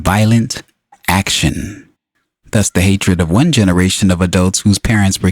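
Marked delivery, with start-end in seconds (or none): none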